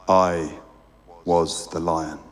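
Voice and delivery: deep calm voice of a great lion; large deep voice. A narrator's reading. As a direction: voice a huge gentle being, low in the chest. The lion reveals himself.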